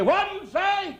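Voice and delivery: shrill-free but high nagging question; wife nagging husband in the car, high. Retold or imitated. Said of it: "she'd say".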